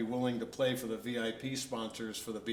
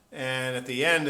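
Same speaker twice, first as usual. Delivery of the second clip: ordinary delivery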